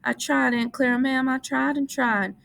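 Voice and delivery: put-on high, miserable childlike plea; miserable Lenny. A narrator's reading. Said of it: "said Lenny miserably".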